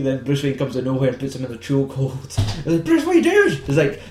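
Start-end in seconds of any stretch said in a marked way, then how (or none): 2.9-3.6 much higher, startled mock woman's voice; shocked Rachel. Imitating Rachel as Bruce chokes Harvey, high and shocked.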